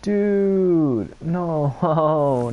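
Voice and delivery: high, loud dismayed cry of no; dismayed protest. Real, not acted. Gamer realizing the wrong character got picked, high dismayed protest. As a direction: recoil at the mistake.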